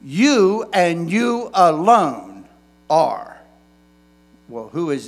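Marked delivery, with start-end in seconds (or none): 0.0-3.4 "You and you alone" slow, louder and higher, each you hit hard; preacher's emphatic "You and you alone are"; emphatic stress on you alone. Shown as a demonstration. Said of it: "it would not be wrong at all for us to say".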